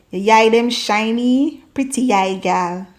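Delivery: slow, sweet patois flattery; Jamaican patois compliment. Shown as a demonstration. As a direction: charm a girl in patois. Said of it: "just say".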